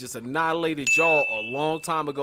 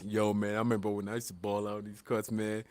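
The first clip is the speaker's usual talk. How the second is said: put-on old baller dad; 7 semitones lower, 6 dB quieter than usual. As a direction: lower and softer, nostalgic. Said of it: "and be like".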